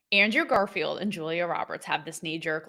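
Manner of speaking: ordinary talk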